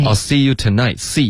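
SEE punched out in "see you tonight"; stress on SEE. Shown as a demonstration. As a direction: put the weight on SEE. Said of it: "C要加重音". (示范：重读 see)

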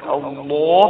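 الله drawn out with a thick ل; heavy lam. Shown as a demonstration. Teacher asking when to use tafkhim, heavy ل in الله. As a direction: make the ل of الله full and heavy. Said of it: "ونفخم اللام".

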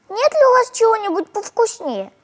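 put-on high, polite childish asking; little girl asking for tastier food. A narrator's reading. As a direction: ask sweetly like a small girl.

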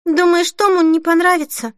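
high, worried disbelief; dismayed question. A narrator's reading. Denise wondering about her groom's reaction, dismayed. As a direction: raise the pitch in dismay.

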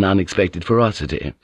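ordinary delivery, nothing marked

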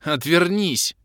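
loud high snarled command; growled order. Acted. Kalen ordering him to turn away, growl. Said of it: "рыкнула Кэлен".

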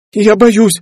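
high-pitched scream of fear; frightened cry. A narrator's reading. Girl faces jumping overboard, frightened cry. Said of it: "закричала Ферби".

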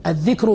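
الذكر as "adh-dhikr", short i; kasra on the dhal. Shown as a demonstration. Teacher quoting a grammarian on speech, الذكر as "dhikr". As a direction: say الذكر with i after the ذ. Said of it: "وذاله مكسورة".

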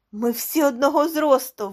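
high, loud realisation; exclaiming. A narrator's reading. Martinez sees the five heads are level, exclaiming. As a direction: burst out with the discovery. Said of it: "вигукнув Мартінес".

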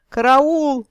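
high, loud cry of alarm; yelling for help. A narrator's reading. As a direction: scream it in panic. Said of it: "благим матом орал".

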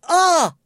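short, very high yelp; loud scream. A narrator's reading. Cook whose hair is yanked, loud scream. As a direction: yelp out as if hurt. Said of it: "فصرخ لينغويني عاليا".

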